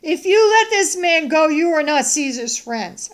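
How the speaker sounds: loud, high, pressuring shout; crowd's outcry. Acted. Crowd pressing Pilate not to release him, crying out. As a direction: cry out a warning to the governor.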